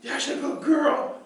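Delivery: high, slow praise; high sing-song praise; praising. Shown as a demonstration. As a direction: coo praise to the dog.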